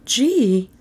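high, drawn-out woman's exclamation; dismayed high-pitched reaction; stunned. A narrator's reading. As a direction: let it out in high, mild shock.

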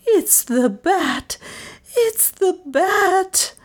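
high, drawn-out eerie wail; slow, eerie high-pitched warning. A narrator's reading. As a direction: stretch it out, high and spooky. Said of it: "whispered Lizzie eerily".

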